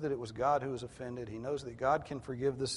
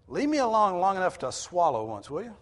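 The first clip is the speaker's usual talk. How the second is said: loud, high, acted exasperation; mock-fed-up outburst; exasperated demand; 6 semitones higher, 8 dB louder than usual. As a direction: complain loudly for a moment's respite. Voices Job's complaint bluntly.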